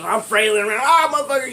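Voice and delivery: high, loud cry of outrage; mock flailing yell. Teller re-enacting himself flailing at Charles, mock yell. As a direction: yell it as if swinging wildly. Acts out flailing while beaten.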